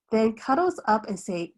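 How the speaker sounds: ordinary delivery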